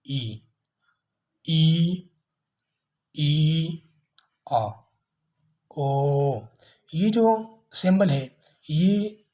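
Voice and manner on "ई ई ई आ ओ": long vowels sounded out slowly one by one; vowel demo. Shown as a demonstration. Teacher sounding out vowels for students, slow and clear. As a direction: draw each vowel out clearly for the class. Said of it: "ये इस तरह अदा किया जाता है".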